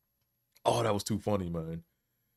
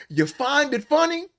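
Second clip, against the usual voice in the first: much higher, slow, strained cry of offence; mock preacher outrage; 4 dB louder than usual. Preacher rages at the laughing cameraman, high strained mock yell. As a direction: stretch it out like a wounded preacher.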